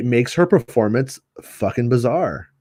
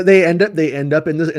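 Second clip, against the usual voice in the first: ordinary talk, nothing marked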